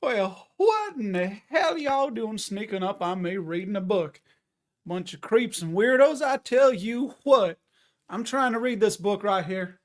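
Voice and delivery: hammed-up Southern grump; Southern accent. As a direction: lightly play a cranky Southern reader caught by surprise. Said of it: "Why is he doing a Southern accent?".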